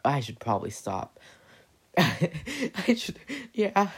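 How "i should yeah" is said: laughing through the words, high and loud. Real, not acted. Catching herself going too far, laughing it off. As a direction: crack up mid-sentence. Laughs at own dark story.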